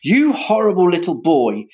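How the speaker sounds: loud, high-pitched outburst of anger; angry roar. A narrator's reading. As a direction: roar it at a naughty boy. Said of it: "he roared".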